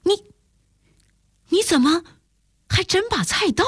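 high-pitched, halting astonishment; startled. A narrator's reading. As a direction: stammer in shock, pitch jumping up. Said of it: "我大吃一惊".

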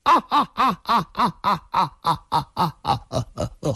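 long, high, staccato wild laugh; wild laughter. A narrator's reading. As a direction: throw out a long crazed laugh. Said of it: "易明堂突然仰面狂笑".

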